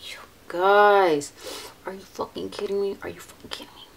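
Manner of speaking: disbelieving hushed whisper; awed whisper. Real, not acted. Vlogger gasping at pigment on her finger, hushed whisper. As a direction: hush your voice in amazement.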